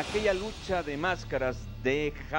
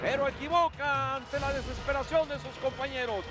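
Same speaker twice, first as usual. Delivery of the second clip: fast, raised play-by-play call; wrestling commentator; 7 semitones higher than usual. Acted. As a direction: call the match action live.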